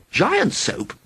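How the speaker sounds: astonished. A narrator's reading. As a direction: blurt it out in disbelief. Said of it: "exclaimed in astonishment".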